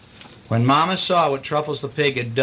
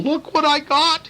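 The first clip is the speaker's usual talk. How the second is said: very high, proud piglet boast; proudly; 13 semitones higher than usual. A narrator's reading. Truffles showing family the cakes, high and proud. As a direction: show it off like a proud little pig. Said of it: "he said proudly".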